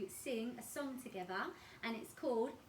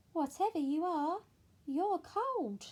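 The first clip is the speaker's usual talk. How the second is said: penguin voice; 7 semitones higher, 7 dB louder than usual. Acted. Penguin speaks to pinecone.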